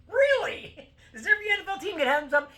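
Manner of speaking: high, loud disbelief; incredulous shout. Real, not acted. Incredulous rant at refs.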